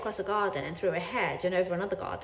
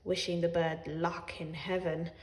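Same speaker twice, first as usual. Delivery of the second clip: ordinary delivery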